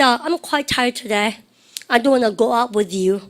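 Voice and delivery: very slow, words stretched; slow example line for students; slow model sentence. Shown as a demonstration. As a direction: read the example slowly. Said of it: "써보세요".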